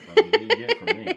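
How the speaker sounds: high squeal through laughter; laughing. Real, not acted. Joking about kid's good heart.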